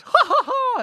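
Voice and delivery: high, loud mocking ha-ha-ha; imitating a manic cackle; hammed-up high laugh; Tucker's mad laugh. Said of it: "when he does their mad laughs".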